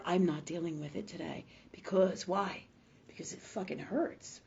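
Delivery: dropped to a near whisper; hushed admission. Retold or imitated. The soul admitting why it avoids pain, hushed. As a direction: sink low and soft, confiding.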